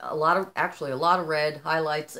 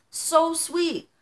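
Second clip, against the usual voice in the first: high, choked-up and a little louder; tearing up; 11 semitones higher, 4 dB louder than usual. Real, not acted. Touched by her husband's gift, voice catching high. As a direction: voice catching with real emotion. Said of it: "I'm tearing up just thinking about it".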